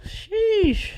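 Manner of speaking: squeaky high exclamation; high-pitched sheesh reaction. Real, not acted. Reacting to the clip.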